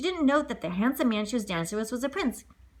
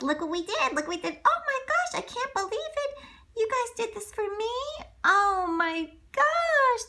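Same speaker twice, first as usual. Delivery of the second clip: put-on high, breathless girl voice, thrilled; a young girl overjoyed at her gift; delighted girl; 13 semitones higher, slower than usual. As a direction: gush over the dress like a young girl. Cinderella thrilled by new dress.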